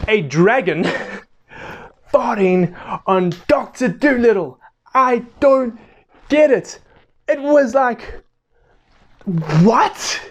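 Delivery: high, baffled disbelief after a laugh; incredulous. Real, not acted. Reviewer recalls dragon farting on Doctor Dolittle, incredulous. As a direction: climb high, utterly lost for an explanation.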